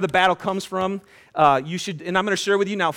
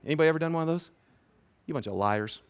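usual speaking voice

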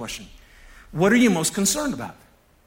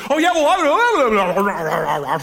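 mock interrupter; 5 dB louder, much slower than usual. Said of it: "this other person goes".

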